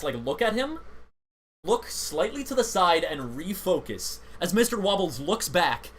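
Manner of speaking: ordinary delivery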